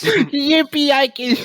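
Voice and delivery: giggly yippee; laughing cheer. Real, not acted. Laughing celebration.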